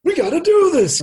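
retold shout urging players on. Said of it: "raising the voice".

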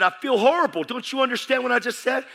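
loud, high, frustrated outburst; exasperated yelling. Retold or imitated. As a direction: yell it down the phone, fed up. Retold outburst at pastor over phone.

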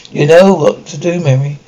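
ordinary talk, nothing marked

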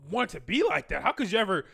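disapproving outsider. Retold or imitated. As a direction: imitate a scandalized onlooker pleading, slow then high. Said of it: "other people like from the outside".

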